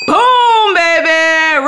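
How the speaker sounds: a big celebratory cheer; excited shout. Real, not acted. Cheering a viewer's match on the scratch ticket, loud excited shout. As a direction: boom it out high and loud, thrilled.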